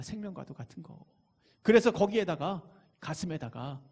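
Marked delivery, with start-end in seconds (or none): 0.0-1.0 softly repeated, dropping away; quiet echo. Real, not acted. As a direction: say it again under the breath. Repeats phrase, second softer. (조용히 되뇌듯)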